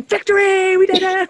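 high, loud victory cheer; a loud, high celebratory shout. Retold or imitated. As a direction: cheer like you just won. Everybody else is going, celebrating.

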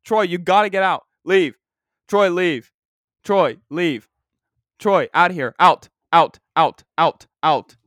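loud, firm barked orders to leave; firm commands. Real, not acted. Owner orders his anxious dog out of the room, loud and firm. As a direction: order the dog out, repeating it sharply.

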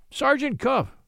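stern rebuke, pitched high. A narrator's reading. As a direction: say it like a finger-wagging reproof. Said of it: "shaking his forefinger at me sternly".

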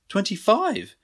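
high, disbelieving question; can't believe what he heard; incredulous. A narrator's reading. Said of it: "Tony said incredulously".

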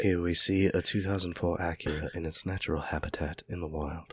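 hushed wildlife narrator; nature documentary narrator. Acted. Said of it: "that's the sound it".

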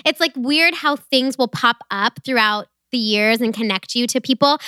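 usual speaking voice

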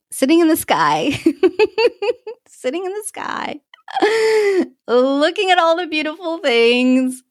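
high, laughing through the words; giddy laughter. Real, not acted. Skydiver reliving the view in the sky, giddy laughter. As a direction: let real giggles break up the blissful words.

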